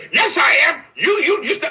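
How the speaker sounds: high, loud mock protest; arguing back. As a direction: shout it back in a staged quarrel. Acts out arguing back at her.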